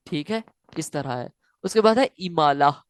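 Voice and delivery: ordinary talk, nothing marked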